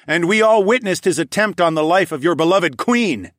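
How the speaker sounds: high, loud oratory; loud proclamation. A narrator's reading. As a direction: declaim to the crowd. Hero addresses crowd.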